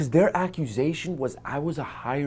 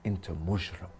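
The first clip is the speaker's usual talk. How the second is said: low quiet insult; 5 semitones lower, 11 dB quieter than usual. Retold or imitated. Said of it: "I said".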